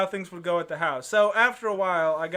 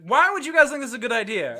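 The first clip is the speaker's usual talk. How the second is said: higher, quick scolding outburst; retold indignant demand, pitched up and rushed; mock scolding; 6 semitones higher, 4 dB louder, faster than usual. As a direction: imitate an exasperated mother telling off kids. Retelling a mother scolding the friends.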